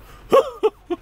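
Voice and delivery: shrill, drawn-out giggle, high up; high hooting laugh. Retold or imitated. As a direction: laugh like Ed Wynn in the film. Ed Wynn laugh imitation.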